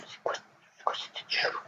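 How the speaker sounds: hushed whisper to himself; puzzled whisper at a weird face. Real, not acted. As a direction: whisper it under your breath. Reacting to a strange face.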